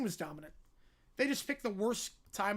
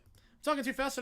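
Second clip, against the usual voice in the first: ordinary talk, nothing marked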